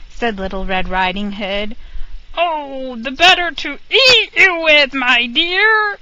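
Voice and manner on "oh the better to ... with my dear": wolf's higher, louder snarl; wolf shouting. A narrator's reading. Hungry wolf lunging at the girl, higher and louder. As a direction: let the wolf pounce with the line. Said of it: "shouted the wolf".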